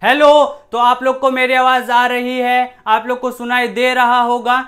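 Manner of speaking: loud projected demo voice. Shown as a demonstration. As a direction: project loudly to the class. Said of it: "जैसे मैं amplitude बढ़ाऊँगा".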